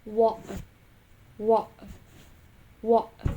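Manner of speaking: "water" with the T replaced by a throat catch; glottal T. Shown as a demonstration. Last example of glottal T, "water" said with a glottal stop. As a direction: stop the breath mid-word in "water", no T sound. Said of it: "pronounced with the glottal".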